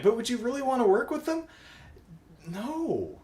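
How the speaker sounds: scoffing at the idea of working with the Stingers; incredulous rhetorical question. Real, not acted. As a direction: ask it like it's absurd.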